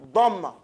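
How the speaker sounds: Dhamma said slowly and clearly; Arabic word Dhamma. Shown as a demonstration. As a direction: model the word Dhamma for students to repeat. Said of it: "Everybody know what Dhamma?".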